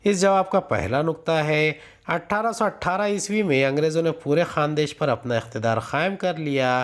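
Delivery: usual speaking voice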